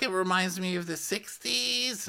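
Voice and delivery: mock Denise. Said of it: "And she's like".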